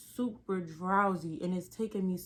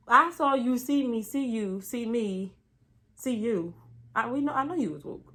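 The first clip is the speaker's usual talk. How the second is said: higher, louder, emphatic; insistent patient; 4 semitones higher, 6 dB louder than usual. Retold or imitated. Patient insisting to the assistant, loud and emphatic. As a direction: hammer the point home.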